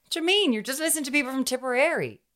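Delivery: high, baffled mock protest; mock surprise. Friend teasing about Newfoundland sounding like Tipperary, high mock surprise. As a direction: play it up as if the accent is baffling.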